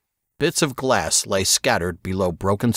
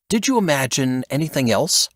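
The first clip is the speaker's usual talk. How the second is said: a higher, sneering question; 6 semitones higher than usual. A narrator's reading. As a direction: scoff at him as you ask it. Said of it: "Silas sneered".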